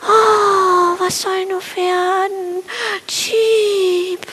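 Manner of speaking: high, crying little sparrow wail; wailing miserably. Acted. Sparrow alone on his branch, high miserable crying cheep. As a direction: whimper it out like a lost little bird. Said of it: "schiebte jämmerlich".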